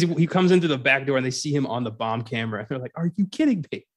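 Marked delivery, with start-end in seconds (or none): 2.9-3.8 higher-pitched mock disbelief; annoyed cops. Cops seeing him on the bomb camera, annoyed. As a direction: imitate exasperated cops. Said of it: "how immediately annoyed the cops are".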